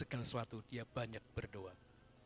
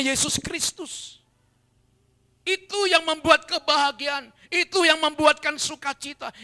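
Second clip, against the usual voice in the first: loud, high, ringing proclamation of joy in Christ; full-force preaching; 20 dB louder than usual. Acted. Pastor proclaiming joy in Christ to the congregation, loud and high. Preacher exalts life in God.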